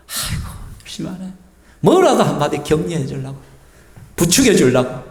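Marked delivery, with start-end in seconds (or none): 0.1-1.3 whispered mock cheer of a kindly elder; lightly voiced elder whispering encouragement; encouraging elder. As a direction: breathe out praise like a doting grandparent. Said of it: "사람들을 세워주고".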